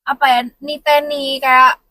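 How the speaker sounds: normal talking voice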